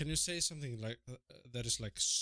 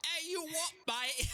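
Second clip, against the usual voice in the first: cheeky British bloke; slower than usual. Retold or imitated. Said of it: "and he says".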